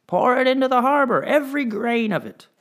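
lady repeating her order in anger; furious command. Acted. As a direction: insist with rising fury.

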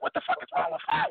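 high, fast shocked outburst; shocked disbelief. Retold or imitated. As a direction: squeak it out in disbelief. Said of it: "I'm like".

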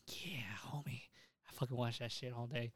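hushed, creepy near-whisper; weirdo voice. Acted. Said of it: "why do you sound like a fucking weirdo now".

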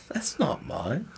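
slow breathy whisper denying; hushed, slow fake denial. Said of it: "penis enlarger pump".

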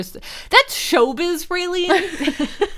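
slow, high, grand and laughing; fancy showbiz voice. Pretend. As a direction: camp it up like an old starlet. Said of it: "the little hand motion [name] just did".

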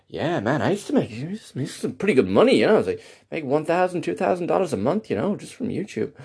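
high-pitched mocking brag; mock bragging colleague. Imitating the colleague bragging about his earnings, high and mocking. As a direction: parody him boasting about YouTube money.

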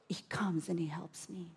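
normal talking voice

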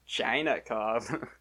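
drawn-out "Chinakhov" with CHY at the start; teasing echo. Retold or imitated. Co-host laughs at the mispronounced name, slow mocking CHY-na-kov for "Chinakhov". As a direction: slowly parrot "Chinakhov" as CHY-na-kov, amused.

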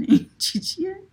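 high, loud manic mimicry of a child; cracking up. A narrator's reading. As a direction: lose it and shriek the child's question. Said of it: "شروع کرد به کندن موهاش و گفتن".

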